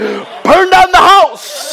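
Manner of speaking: high, loud, slow hammed-up shout; laughing hammed-up battle cry; mock rage yell. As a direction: roar it like a maniac. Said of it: "it's that kind of mentality".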